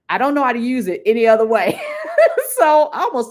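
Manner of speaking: amused, laughter spilling out at the end; laughing. Real, not acted. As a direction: admit it, breaking into a laugh. Laughs at her own cluelessness.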